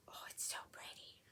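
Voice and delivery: soft admiring whisper; hushed, delighted whisper. Real, not acted. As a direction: breathe it out in awe. Admiring makeup shade, whispered.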